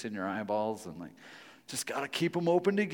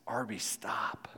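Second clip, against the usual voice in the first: whispered, slow warning; soft warning whisper; 3 semitones lower, 8 dB quieter, slower than usual. Retold or imitated. God warning the preacher softly, whispered slow. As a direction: whisper it like a still small voice.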